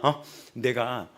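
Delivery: usual speaking voice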